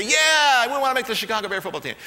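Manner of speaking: loud, high eager team shout; eager rookies shouting. Retold or imitated. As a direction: shout back like hungry recruits. Rookies answer coach eagerly.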